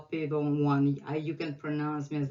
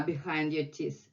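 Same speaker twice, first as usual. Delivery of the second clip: ordinary delivery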